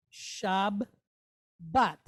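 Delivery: "Shab", pause, "bat"; split syllables. Shown as a demonstration. Teacher building the word, split "Shab, bat". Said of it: "we don't pronounce it Shabbat".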